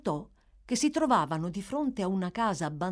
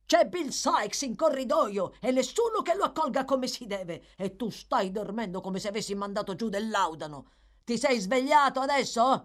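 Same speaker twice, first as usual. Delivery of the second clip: high-pitched irate tirade; exasperated, threatening rant; shouted scolding; 5 semitones higher, 3 dB louder than usual. A narrator's reading. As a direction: berate a dozing servant loudly. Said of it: "urlò la voce di prima".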